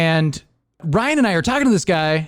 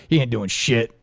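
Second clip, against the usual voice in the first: imitating buddy; 8 semitones lower than usual. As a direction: mimic the buddy's low, offhand confidence. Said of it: "But then you're like".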